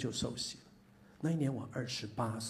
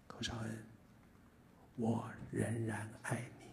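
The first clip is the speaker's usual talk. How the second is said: 1 gentle voice of God; 3 semitones lower, 8 dB quieter than usual. Retold or imitated. Voicing God's gentle words.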